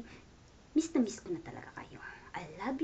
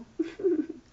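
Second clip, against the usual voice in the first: laugh; 10 semitones higher, 7 dB louder than usual. Real, not acted. Laugh after joking remark.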